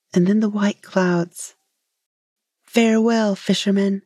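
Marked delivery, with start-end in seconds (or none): none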